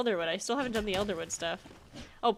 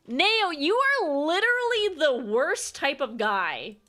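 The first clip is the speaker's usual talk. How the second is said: slow, higher, louder disgusted complaint; exasperated; 6 semitones higher, 4 dB louder, slower than usual. Real, not acted. Player telling off Neo for regifting, exasperated. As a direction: drag it out in total disbelief.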